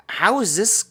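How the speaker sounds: high, incredulous retold protest; exasperated questioning. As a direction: protest in disbelief, pitch jumping up.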